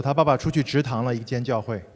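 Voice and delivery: usual speaking voice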